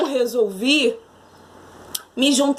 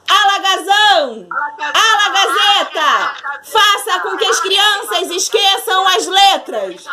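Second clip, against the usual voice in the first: witch spell shout; 4 semitones higher than usual. Acted. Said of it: "palavras mágicas".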